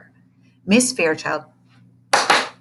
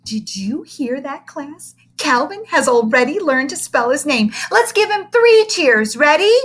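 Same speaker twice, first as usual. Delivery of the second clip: gushing teacher; 7 semitones higher, 6 dB louder than usual. Acted. Teacher coos at the big boy, loud and high. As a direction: overdo the sweetness like a doting teacher.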